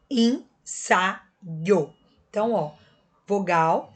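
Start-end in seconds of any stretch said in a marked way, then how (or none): 0.1-1.2 ensaio broken into en, sa, io with pauses; syllable by syllable. Shown as a demonstration. Teacher separates ensaio for the class, syllable by syllable. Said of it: "quando eu separo".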